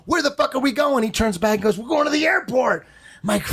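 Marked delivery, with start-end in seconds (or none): 0.1-1.0 acted panic. Acts out panicked question to driver. 1.8-2.8 acted driver. Voices driver turning back.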